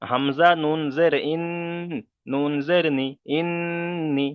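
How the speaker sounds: slow spelling, long hummed n in ইন্নি; ghunnah demo. Shown as a demonstration. Teacher showing how to read it, ইন্নি with a drawn-out nasal n. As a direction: hold the double ন of ইন্নি evenly through the nose. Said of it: "এখানে কেমন করে পড়তে হবে".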